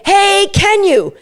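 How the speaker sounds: high, slow, sing-song "Hey, can you?"; excitable cue. Shown as a demonstration. Trainer demoing the muzzle cue, excitable. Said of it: "say it in a really excitable way".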